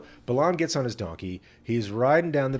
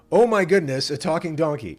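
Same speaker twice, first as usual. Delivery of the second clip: loud, high gasp of astonishment; hammed-up shock at a talking animal; mock amazement; 5 semitones higher, 7 dB louder than usual. Balaam marvels at his talking donkey, hammed-up surprise. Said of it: "No, that's not what he says".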